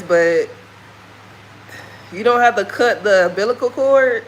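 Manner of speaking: normal talking voice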